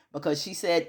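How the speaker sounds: ordinary talk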